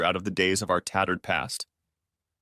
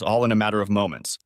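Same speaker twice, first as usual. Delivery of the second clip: ordinary delivery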